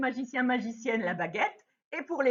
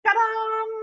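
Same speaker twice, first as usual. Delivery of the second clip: high bright announcing cry; mock fanfare; 11 semitones higher, 6 dB louder than usual. Teacher unveiling the knight's costume to children, mock fanfare. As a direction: present it like a magic trick reveal.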